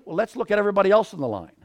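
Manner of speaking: usual speaking voice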